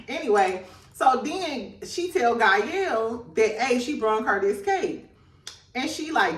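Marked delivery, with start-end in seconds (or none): none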